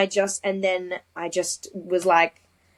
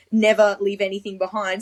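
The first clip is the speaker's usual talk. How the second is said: usual speaking voice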